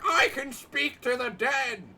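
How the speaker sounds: mock crazy spiritualist proclamation; mocking a medium. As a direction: ham up a dramatic psychic. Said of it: "crazy bitch who's like".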